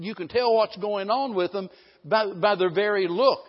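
normal talking voice

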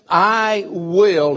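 "I will" said firmly and loudly, both words hit; emphatic. Retold or imitated. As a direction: state it with total resolve. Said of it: "He is emphatic about it".

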